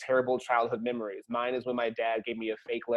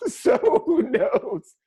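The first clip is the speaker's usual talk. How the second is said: laughing; 13 semitones higher, 6 dB louder, much slower than usual. Real, not acted. Laughing at own trauma story.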